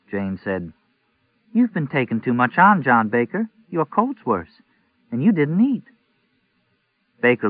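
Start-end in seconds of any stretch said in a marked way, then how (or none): 1.5-5.9 a hint of a fussing wife, higher; wife chiding her sick husband over supper, lightly voiced; lightly voiced worried wife. A narrator's reading. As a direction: scold him gently, higher. Jane voiced, scolding husband.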